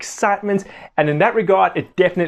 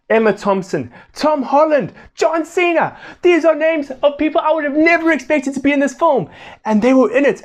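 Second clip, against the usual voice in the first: amazed excitement; 10 semitones higher than usual. Real, not acted. Reviewer listing surprise big names in the cast, amazed. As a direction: pitch climbing high with each name.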